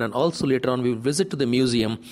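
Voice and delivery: usual speaking voice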